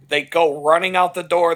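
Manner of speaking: ordinary talk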